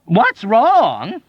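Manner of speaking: high, loud rising echo of wrong; incredulous cry. A narrator's reading. Doctor crying out at the soldier. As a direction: throw the question back in outrage. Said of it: "Doctor Nika cried".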